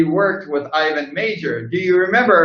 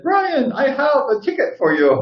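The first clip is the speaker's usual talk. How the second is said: official voice; 9 semitones higher than usual. Retold or imitated. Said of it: "came up to me and said".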